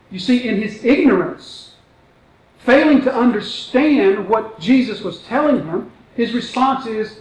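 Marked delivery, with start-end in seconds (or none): none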